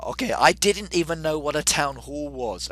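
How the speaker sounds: ordinary talk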